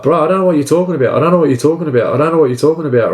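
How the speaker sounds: quick-fire imitation of a phone denial; rapid denial. Neighbour's repeated denial on the phone.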